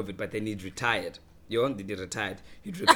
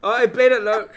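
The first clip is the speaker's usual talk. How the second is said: laughing through the words, high and loud; can't stop giggling while telling it; real laughter bubbling through; 8 semitones higher, 9 dB louder than usual. Real, not acted.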